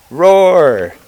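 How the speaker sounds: loud, high, drawn-out dinosaur roar; storybook roar. Acted. Dinosaur roars for the marching animals, loud storybook roar. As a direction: let out a big playful roar.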